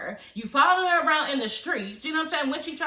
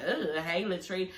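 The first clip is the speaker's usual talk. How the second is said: imitated sexual come-on; 4 semitones lower, 6 dB quieter than usual. Recapping how he greeted Latrice, mock sexual come-on. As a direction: lightly mimic a man's suggestive greeting. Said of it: "real sexual".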